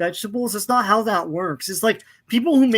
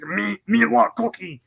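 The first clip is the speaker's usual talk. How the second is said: Cookie Monster voice. Retold or imitated. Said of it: "he's always like".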